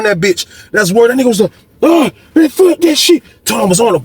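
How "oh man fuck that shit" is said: high-pitched retold protest from the beaten man; mock outcry. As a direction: imitate his outcry, voice jumping way up. Retold cry of man being beaten.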